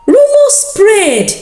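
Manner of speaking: ordinary delivery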